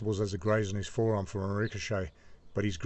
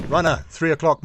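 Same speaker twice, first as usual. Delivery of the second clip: loud, high warning call; driver's shout; 6 semitones higher, 8 dB louder than usual. A narrator's reading. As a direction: shout the sighting to the gunner. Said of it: "my driver shouts".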